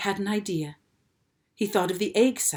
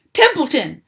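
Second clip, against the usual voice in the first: loud, higher scream of a name; 7 semitones higher, 10 dB louder than usual. A narrator's reading. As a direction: scream to wake him. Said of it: "screamed Wilbur".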